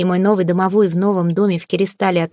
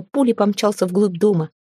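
ordinary delivery

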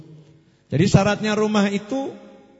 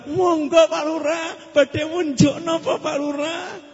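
fawning welcome; 9 semitones higher, slower than usual. Acted. Wife greeting village head obsequiously.

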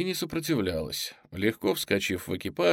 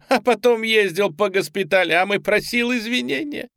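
mocking; 7 semitones higher, 4 dB louder than usual. A narrator's reading. Woman mocking the lieutenant.

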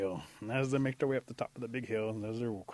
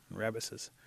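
ordinary delivery

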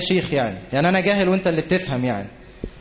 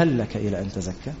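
much softer, lower, هل rising like an offer; gentle invitation; 9 semitones lower, 6 dB quieter than usual. Shown as a demonstration. Moses gently inviting Pharaoh, soft and lower. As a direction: offer هل لك إلى أن تزكى as a kind question. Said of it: "أسلوب رائع جدًا في المعاملة".

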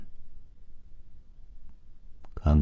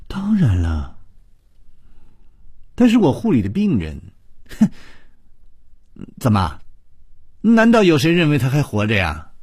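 higher and louder, rising in disbelief; agitated retort; 9 semitones higher, 4 dB louder than usual. A narrator's reading. Nurse rejecting the patient's claim, higher and louder. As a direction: push back hard, voice climbing.

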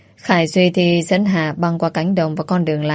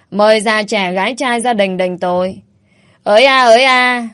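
village crier bawling; 3 semitones higher than usual. Acted. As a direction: strain your throat announcing to the whole village. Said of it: "gân cổ rồi gào lên".

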